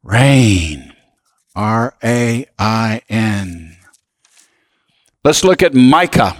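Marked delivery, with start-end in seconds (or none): 0.0-3.8 slow spelled emphasis. Shown as a demonstration. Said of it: "spelling the key word".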